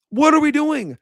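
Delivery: repeated in disbelief, nearer his own voice; fed-up what are we doing; exasperated question. Real, not acted. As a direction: ask it again, still annoyed but settled.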